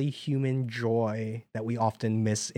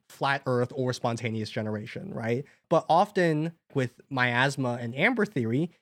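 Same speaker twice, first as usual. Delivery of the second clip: ordinary talk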